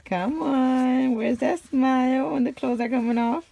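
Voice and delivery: high loud singsong, slow. Real, not acted. Playful talk to baby.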